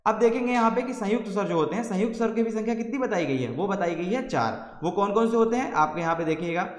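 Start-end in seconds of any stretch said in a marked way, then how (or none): none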